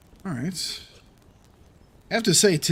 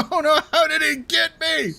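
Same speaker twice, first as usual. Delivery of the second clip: higher, laughing squeaky voicing; surprised boar voice; 10 semitones higher than usual. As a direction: voice the boar's shocked whine. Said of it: "He's like".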